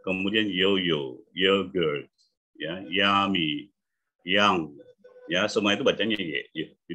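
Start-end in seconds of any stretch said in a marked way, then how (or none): 0.0-1.2 yo-yo said slowly with a clear Y at the start; slow pronunciation demo. Shown as a demonstration. Coach demonstrating the Y sound to students, slowly. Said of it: "jadi bunyi konsonan". 2.6-3.7 yummy said slowly with a clear Y at the start; slow pronunciation demo. Shown as a demonstration. Coach demonstrating the Y sound to students, slowly. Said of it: "Y-nya kan bunyi Y ya".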